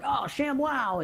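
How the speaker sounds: drawn-out, high TV pitchman cry. Retold or imitated. Salesman hawking homewares on TV, pitchman voice. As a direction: sell it like a TV pitchman. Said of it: "they're called pitchmen".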